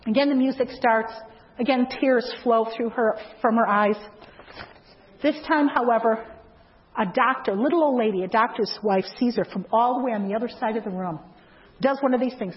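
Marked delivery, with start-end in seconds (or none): none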